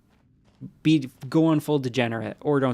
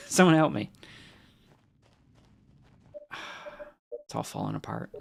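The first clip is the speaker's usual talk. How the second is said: usual speaking voice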